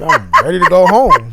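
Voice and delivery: laughing retelling of his own words; laughing through it. Retold or imitated. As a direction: crack up mid-quote at the absurdity. Laughs through the retold quote.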